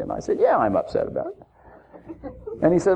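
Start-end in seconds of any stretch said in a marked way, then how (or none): 0.4-1.4 higher, huffy admission of being upset; mock indignant. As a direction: own it with playful indignation. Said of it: "You're upset about that, aren't you?".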